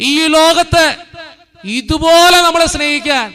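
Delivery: loud, high, impassioned sermon voice; fervent preaching. Acted. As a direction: preach it to the whole church with fire. Preaching at full force. (ഉച്ചത്തിൽ ആവേശത്തോടെയുള്ള പ്രസംഗം)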